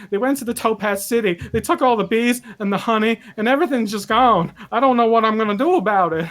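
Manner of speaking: high, loud, frantic and rising; babbling in rising panic, near fainting; panicked apprentice. Acted. GM voices panicked beekeeper NPC.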